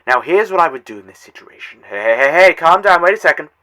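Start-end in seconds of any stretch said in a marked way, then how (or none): 1.9-3.5 loud calming. Acted. Calming a panicking viewer.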